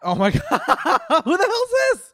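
cracking up in shock; laughing disbelief. Real, not acted.

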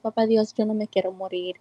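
ordinary delivery

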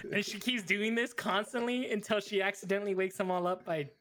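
usual speaking voice

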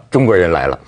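a Mexican mournfully laments; mournful sigh. Retold or imitated. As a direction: say it like a sad defeated sigh. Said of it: "墨西哥人悲哀地说".

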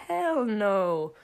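loud emphatic hell no; flat-out refuse it loudly. Real, not acted. (painokkaasti ja kovaa)

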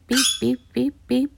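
imitated beeping. Imitating the machine.

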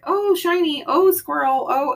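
high-pitched easily distracted voice; distracted squirrel voice. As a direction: playfully act scatterbrained. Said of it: "I squirrel a lot".

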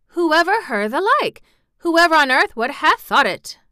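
amazed exclamation to herself; astonished. A narrator's reading. Mrs Medlock alone in the corridor, astonished. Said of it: "she was so astonished".

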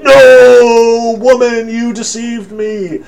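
long, loud, high wail easing off; hammed-up melodramatic cry of betrayal; mock anguished Adam. Adam learning that Eve tricked him, mock-anguished wail.

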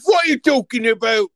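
loud, high protest; mock outrage. Cohost protesting Keith's verdict, shouting.

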